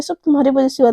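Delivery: usual speaking voice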